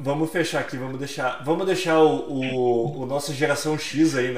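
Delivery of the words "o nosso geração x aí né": usual speaking voice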